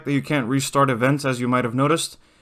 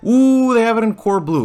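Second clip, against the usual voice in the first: excited ooh; 8 semitones higher, 4 dB louder than usual. Real, not acted. Spots desired car color.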